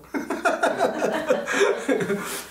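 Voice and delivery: long, high-pitched chuckle; amused run of high laughter; hearty laugh. Real, not acted. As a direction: laugh at your own punchline. Laughs at the punchline he retold.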